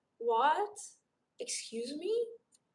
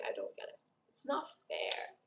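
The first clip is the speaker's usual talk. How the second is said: whisper; 8 dB quieter than usual. Real, not acted. Muttered under breath.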